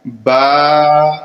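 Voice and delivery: با with both lips pressed closed before release; با with a firm bilabial stop; lips together. Shown as a demonstration. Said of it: "دونوں ہونٹوں کو آپس میں ملا کر پڑھیں گے".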